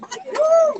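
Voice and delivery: high whooping cheer; excited whoop. Real, not acted. [name] whoops to rally the crowd, high excited whoop. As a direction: let out a big whoop to fire up the crowd.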